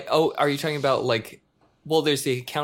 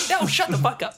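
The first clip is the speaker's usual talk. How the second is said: much higher mock retort; mock shout back. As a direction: snap it back in a squeaky put-on voice. Mock reply to hype man.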